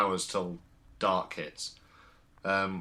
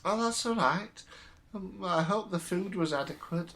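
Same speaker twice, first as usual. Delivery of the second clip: put-on high, thin old lady voice; fussy elderly landlady showing rooms; very old woman; 9 semitones higher than usual. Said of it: "June sort of comes in and goes".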